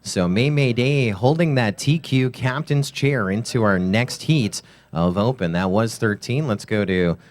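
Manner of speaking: brisk live race commentator; play-by-play commentary. Acted. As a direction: call the race live, keep momentum.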